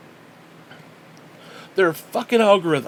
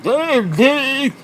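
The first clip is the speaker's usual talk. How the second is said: high, loud mock show-off; 6 semitones higher, 4 dB louder than usual. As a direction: imitate someone flaunting new teeth, pitched up. Mocking people showing off new teeth.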